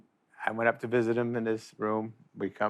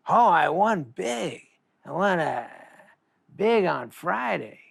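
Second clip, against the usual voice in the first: slow, higher-pitched brag; mock boast; 6 semitones higher, slower than usual. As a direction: savour the big win, imitating a gambler. Retelling a gambler's boast.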